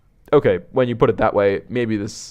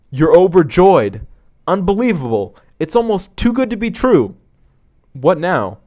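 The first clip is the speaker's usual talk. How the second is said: elated narration, pitched higher; overjoyed; 7 semitones higher, 4 dB louder than usual. A narrator's reading. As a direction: marvel at unbelievable good luck. Narrator voices the overjoyed thoughts.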